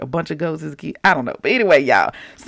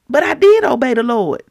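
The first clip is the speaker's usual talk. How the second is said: louder, higher repeat of the objection; emphatic acted insistence; insistent protest; 6 semitones higher, 5 dB louder than usual. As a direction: push hard on DID in self-defence. Saul repeats his protest more forcefully.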